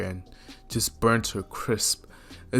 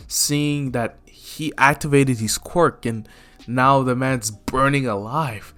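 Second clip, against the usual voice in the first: ordinary delivery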